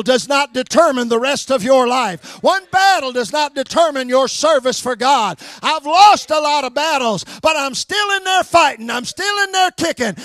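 loud, ringing sermon delivery; fiery revival preaching; preaching at full force. Acted. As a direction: preach it out to the back pews. Preacher rousing the congregation.

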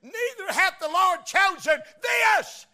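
slow, high, shouted proclamation; slow, booming high proclamation; Samuel's proclamation. Retold or imitated. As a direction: call it out like a prophet. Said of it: "And he said".

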